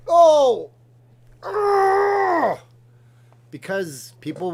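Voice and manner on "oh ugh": sharp high cry of pain; loud yelp and groan. Real, not acted. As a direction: yelp as the claws dig in. Pained cry when cat attacks.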